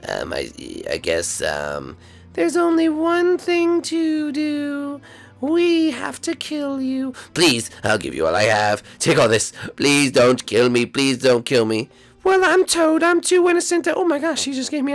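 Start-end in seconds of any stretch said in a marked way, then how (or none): 0.1-2.0 very low, hesitant boss; deep villain voice. Acted. Villain at a loss. 7.3-11.9 deep pleading villain. Acted. Villain begs for mercy.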